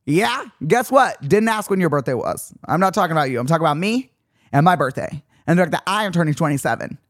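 high, mock-annoyed telling-off; half-joking indignant rant; mock-irritated rant. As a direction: scold them playfully, pitch pushed up.

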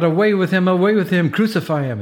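urgent high-pitched crowd cry; narrated mob outcry, pitched well up. A narrator's reading. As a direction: cry out high, demanding it over and over. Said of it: "They cried out".

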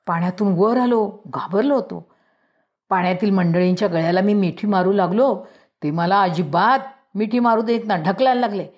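ordinary delivery, nothing marked

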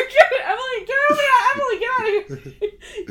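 high, loud cartoonish voice with laughter; silly high voice. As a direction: shoo Emily off in a goofy squeal. Mock Emily Blunt voice, laughing.

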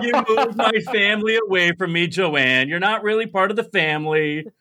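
high, lilting mock lament; hammed-up self-pitying complaint; depressed grandma. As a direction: imitate a grandma's doleful sing-song reproach. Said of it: "she was so depressed".

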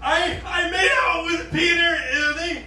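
high-pitched tearful wail, hammed up; mock crying. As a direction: imitate a panicked girl wailing through tears. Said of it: "that lady started crying, and she goes".

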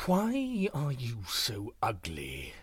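slow, low menacing tiger; demanding tiger. A narrator's reading. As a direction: loom over the deer and demand it. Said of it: "demanded the tiger".

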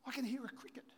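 near-whispered remark; hushed noticing. Acted. American Indian telling his friend, hushed and intent. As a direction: say it under your breath, intently. Acted hushed discovery in story.

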